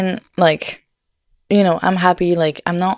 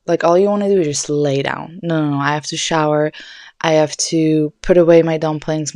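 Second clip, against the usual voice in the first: normal talking voice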